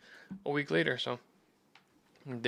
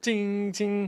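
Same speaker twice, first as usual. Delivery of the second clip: bell ding; 7 semitones higher than usual. Shown as a demonstration. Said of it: "the ten bells".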